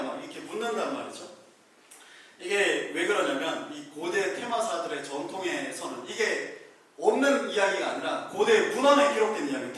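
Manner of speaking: usual speaking voice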